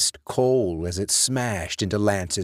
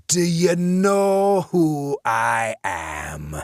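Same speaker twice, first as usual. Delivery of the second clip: higher, slow, louder taunting question; menacing ghost question; 6 semitones higher, 4 dB louder, much slower than usual. A narrator's reading. Dead man asks the cowering Lance, menacing. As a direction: loom over him and ask.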